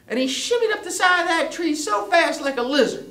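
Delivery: high, loud chase narration; excited story narration. A narrator's reading. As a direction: tell the chase at full pitch, stretching key words.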